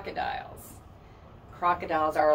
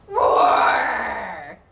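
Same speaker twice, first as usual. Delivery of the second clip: loud pretend lion roar; playful roar; 7 dB louder than usual. Teacher roaring like a lion for the children. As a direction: roar big for the kids. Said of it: "And I shall roar some more".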